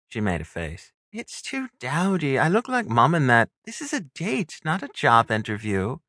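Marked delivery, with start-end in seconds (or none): 1.1-6.0 put-on high-pitched young woman's voice, protesting; objecting stepsister. Stepsister rejects the trouser suit for a date, objecting. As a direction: complain in a higher character voice.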